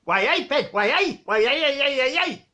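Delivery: high, chirpy Geordie greeting; hammed-up Geordie hello, repeated; Geordie accent. Geordie guy greeting Victor, jolly Geordie accent. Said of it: "he gets talking to this Geordie guy".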